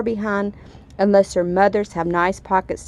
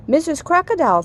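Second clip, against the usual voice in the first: calling out; 9 semitones higher, 4 dB louder than usual. Acted. Katy calling to the crocodile.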